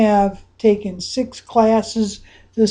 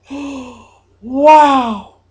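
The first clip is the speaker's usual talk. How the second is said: 1 gasp of wonder; 2 semitones higher, 5 dB louder than usual. Acted. Gasp while anchoring feeling.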